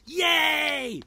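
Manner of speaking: very high, very loud yay; crowd cheer. Acted. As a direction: cheer like a gang just freed. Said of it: "cried all the other badgers".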